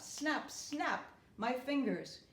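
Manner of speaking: usual speaking voice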